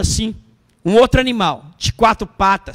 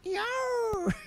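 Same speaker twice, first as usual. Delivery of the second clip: a tiny, much softer mock roar; meek lion roar; 11 semitones higher, 15 dB quieter than usual. Preacher shows the tame lion's soft mock roar to the congregation. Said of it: "não fez assim, com raiva".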